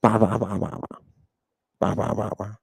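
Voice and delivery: soft, monotone "ba ba ba" babbling; quiet mocking babble; mock barbarian babble. Shown as a demonstration. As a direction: imitate someone who can't speak Greek. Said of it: "That's what you sound like".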